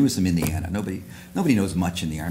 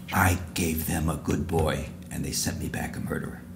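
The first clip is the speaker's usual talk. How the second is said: hushed retold lament; 3 semitones lower, 4 dB quieter than usual. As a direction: let it fall softly, word by word. Mother's grave words, climax of story.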